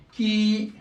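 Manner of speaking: "Qui" with a drawn-out ee; long vowel. Shown as a demonstration. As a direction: hold the i in "Qui" for two beats. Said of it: "prolongation de deux temps".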